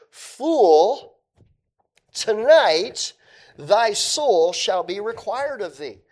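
loud, high declaimed judgment; stern pronouncement. Acted. Preacher voices God's rebuke to the rich man, stern and loud. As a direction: thunder out the verdict, with weighty pauses.

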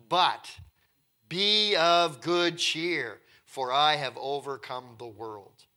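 loud, very slow, emphatic Scripture quote; triumphant declamation. Acted. Christ promising he has overcome the world, declaimed slow and loud. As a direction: proclaim it loudly, stretching each word.

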